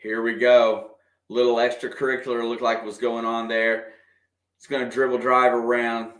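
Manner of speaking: usual speaking voice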